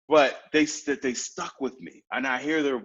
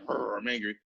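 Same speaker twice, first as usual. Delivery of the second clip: low, soft little mock growl; half-hearted anger; 6 semitones lower, 6 dB quieter than usual. Shown as a demonstration. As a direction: grumble it low without conviction. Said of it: "just don't be angry. Don't be like".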